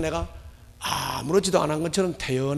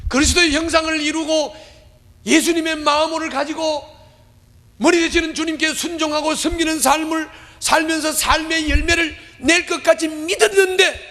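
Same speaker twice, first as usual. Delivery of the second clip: rising, emphatic sermon build; fervent pulpit delivery; preaching; 11 semitones higher, 4 dB louder than usual. Acted. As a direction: preach it up higher and harder. Preacher's raised sermon climax.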